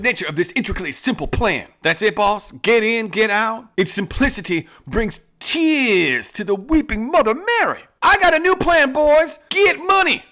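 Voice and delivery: loud, high hammed-up outlaw boss; Western outlaw boss. Boss bragging about his plan to the gang, in a loud, high hammed-up outlaw voice. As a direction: play the swaggering bandit chief to his gang.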